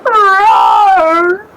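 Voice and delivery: loud crying roar rising at the end; a mournful lion roar, loud and crying; sad roar. Acted. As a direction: roar like a wounded animal. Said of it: "There was sadness in the echoing roar".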